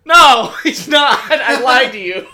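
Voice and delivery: high, loud laughing tease; gotcha, said with a laugh; teasing dad. Retold or imitated. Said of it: "He goes".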